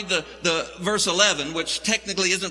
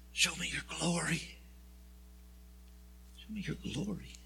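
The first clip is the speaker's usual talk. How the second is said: hushed plea, much softer; whispering; 13 dB quieter than usual. Acted. Moses pleading with God, awed whisper. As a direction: breathe the plea in awe. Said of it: "And Moses whispers".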